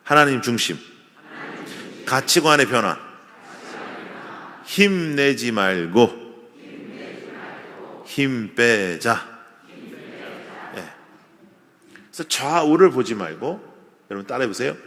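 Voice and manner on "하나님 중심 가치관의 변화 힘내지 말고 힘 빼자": very slow, each word drawn out for the congregation to repeat; slow call-and-response. Shown as a demonstration. Pastor leading his congregation in a slow repeated slogan. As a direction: lead the congregation slowly. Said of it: "다시 한번 따라해봐요".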